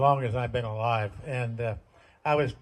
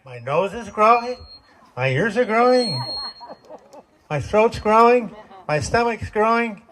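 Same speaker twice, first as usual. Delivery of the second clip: indignant protest; 6 semitones higher, 4 dB louder than usual. Retold or imitated. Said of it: "And I said".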